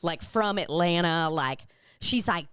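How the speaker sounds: ordinary talk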